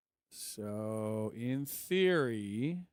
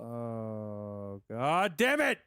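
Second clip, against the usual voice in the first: frustrated curse; 4 dB louder, much slower than usual. Real, not acted. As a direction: swear at the error. Error message appears.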